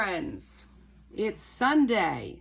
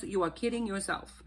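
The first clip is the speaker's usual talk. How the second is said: normal talking voice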